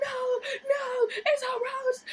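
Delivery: high, loud crying protest; re-enacted tearful shriek; panicking. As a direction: wail back in hysterical denial. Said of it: "A bitch was over there panicking".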